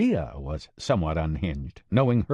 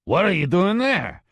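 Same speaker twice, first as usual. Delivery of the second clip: gruff gravelly voice; 10 semitones higher, 4 dB louder than usual. Acted. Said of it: "a very gruff, gravelly voice".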